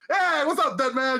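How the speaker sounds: high-pitched, laughing taunt; hammed-up cocky greeting; mock trash talk. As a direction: imitate a wrestler goading his opponent. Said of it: "talking shit to each other".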